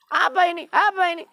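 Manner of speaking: very high, loud demand; demanding shout. Acted. Umar sees the pages and loudly demands answers. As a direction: snap the question out.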